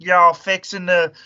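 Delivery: high, slow cartoon-hillbilly Southern drawl; heavy Southern accent. Imitating a Wendy's worker, heavy Southern accent. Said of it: "he had, like, the heaviest accent".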